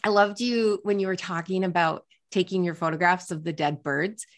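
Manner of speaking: usual speaking voice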